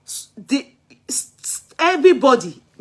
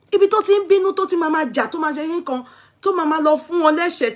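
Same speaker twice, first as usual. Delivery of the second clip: higher, building indignation; rising outrage at his antics; worked up; 7 semitones higher, much faster than usual. Real, not acted. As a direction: pitch it up and push through. Describing angry rapper's behaviour.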